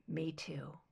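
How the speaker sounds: soft tender whisper. Acted. Son squeezes his mother's hand, soft whisper. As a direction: breathe it, barely voiced.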